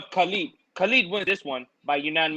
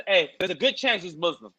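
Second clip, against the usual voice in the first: usual speaking voice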